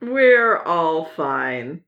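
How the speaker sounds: very slow, stretched-out reassurance; drawn-out reassurance. Pretend. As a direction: stretch every word out. Drawn-out joking echo.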